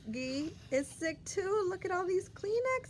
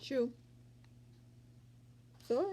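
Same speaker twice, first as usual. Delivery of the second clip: usual speaking voice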